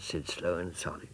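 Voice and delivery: plain reading voice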